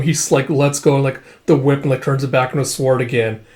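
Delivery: normal talking voice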